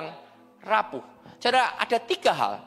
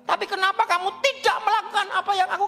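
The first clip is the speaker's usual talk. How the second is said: higher, rebuking as the Lord; stern voice of God; 9 semitones higher than usual. Acted. Preacher voicing the Lord rebuking the congregation, stern. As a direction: voice the Lord scolding the crowd.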